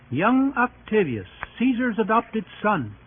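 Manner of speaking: normal talking voice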